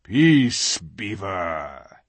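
lion's warning, dropping low; very low growl. A narrator's reading. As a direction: growl it, sinking away on the name. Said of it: "with a very low growl".

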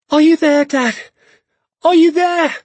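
high, loud desperate call; shouting for Dad. A narrator's reading. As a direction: shout through the dark for a lost father. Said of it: "I shouted".

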